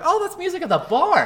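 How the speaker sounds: high, loud, gushing surprise; delighted realization. Retold or imitated. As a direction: act out a happy little discovery. Acting out own reaction in game.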